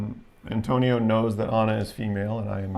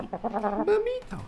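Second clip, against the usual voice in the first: cooing pet name; much slower than usual. Real, not acted. Calling the rooster by a pet name, high slow coo. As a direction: stretch it out high and sweet.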